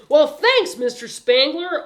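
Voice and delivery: put-on high, loud boyish gratitude; excited newsboy's voice; eager thanks. A narrator's reading. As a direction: burst out with keen thanks. Boy thanking his future boss.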